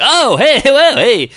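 high, loud mock protests; backtracking talk show host. Mimicking a conservative host constantly backtracking, high loud interjections. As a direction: cut in loudly like a host backing off. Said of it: "conservative talk show host who's ... constantly backtracking".

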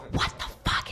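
whispered to myself; a stunned whisper in my head; mouthing it silently in disbelief; inner whisper. Retold or imitated. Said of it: "In my mind".

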